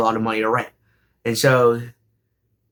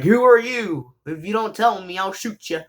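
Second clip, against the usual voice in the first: put-on high, demanding old lady voice; mock-threatening grandma, pitched up; grandma grilling a stranger at the door, high and menacing; scary grandma; 6 semitones higher than usual. Grandma confronting the girlfriend.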